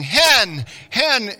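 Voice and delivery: loud, high warning shout of hen; yelling. Retold or imitated. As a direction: yell hen to stop the shot. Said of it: "they're yelling".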